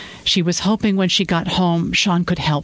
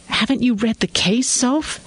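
high, accusing disbelief; 8 semitones higher than usual. Acted. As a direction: accuse her of not reading it. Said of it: "he asked accusingly".

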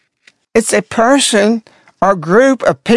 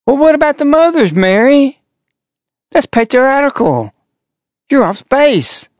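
indignant retort; 5 semitones higher than usual. Real, not acted. Sarcastic retort to the quoted message.

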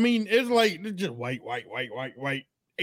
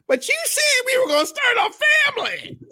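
put-on whiny girlfriend voice; faster than usual. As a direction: wail it like a jilted woman. Voicing the abandoned girlfriend.

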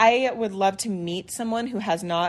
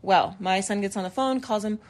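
ordinary delivery

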